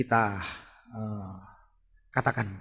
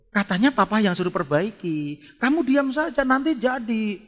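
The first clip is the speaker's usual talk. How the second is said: raised-pitch dad's retort; exasperated father; 10 semitones higher, 5 dB louder than usual. Acted. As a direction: hush a meddling child in protest. Father retorts to meddling child. (suara bapak jengkel)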